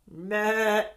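loud bleating meh; loud playful baa; sheep bleat. Acted. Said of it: "said the sheep".

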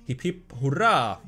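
slow, hammed-up hip hip hurray; mock cheer. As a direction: cheer it like a tiny party, tongue in cheek. Mock cheer over a pick.

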